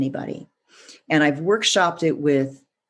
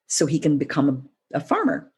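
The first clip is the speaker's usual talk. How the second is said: usual speaking voice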